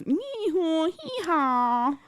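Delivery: high-pitched drawn-out donkey bray; silly imitated whining bray; mock hee-haw. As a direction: bray it high like a cartoon Grinch. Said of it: "Sound like the Grinch".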